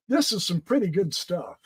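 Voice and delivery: normal talking voice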